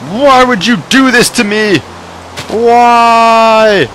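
high wailing complaint; mock despair. Real, not acted. Gamer wailing at the enemy Pokemon. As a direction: plead to the game in comic despair.

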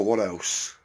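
ordinary talk

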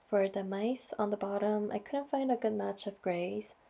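ordinary talk

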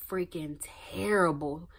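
usual speaking voice

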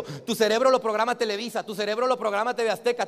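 ordinary talk, nothing marked

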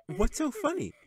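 put-on high, light child's voice; little girl voice. A narrator's reading. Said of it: "she asked".

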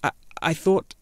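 put-on hesitant young boy, caught out; stammering. A narrator's reading. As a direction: falter on the first word, flustered. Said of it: "Atreyu stammered".

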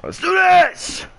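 high, slow, loud hype shout; excited shout. Real, not acted. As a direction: yell it to pump yourself up. Game restarts, hyped.

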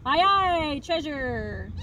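loud cheer from the pirate crew; crew shout. Acted. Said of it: "the others shouted".

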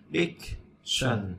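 Dictionary said slowly, each syllable spaced out; slow syllable demo. Shown as a demonstration. Teacher spelling out Dictionary syllable by syllable, slowly. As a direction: break Dictionary into slow, separate syllables for the class. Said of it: "Dic là y ngắn".

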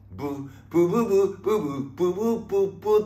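high, short mouth-trumpet toots; imitated trumpets. Host mimicking the variety-show band, imitated toots. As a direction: toot a halting brass tune. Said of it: "ラッパとか吹いてんねん".

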